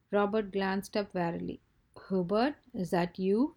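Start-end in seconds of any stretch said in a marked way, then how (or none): none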